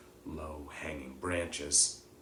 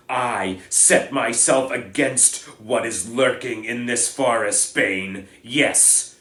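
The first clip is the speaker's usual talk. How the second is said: loud, higher, passionate defiance; furious defiance; 5 semitones higher, 13 dB louder than usual. Acted. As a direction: stand against Bane with full force. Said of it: "Firenze bellowed at Bane".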